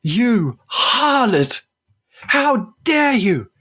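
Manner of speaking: jealous stranger shrieking accusations; furious yell. Acted. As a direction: scream it across the room at her. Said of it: "someone behind you yells".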